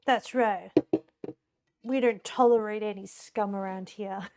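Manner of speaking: gruff hero voice, proud and firm; vigilante voice. Pretend. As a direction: growl like a street vigilante. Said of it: "That's my best vigilante voice".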